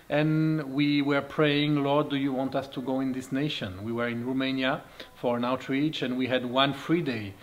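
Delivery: normal talking voice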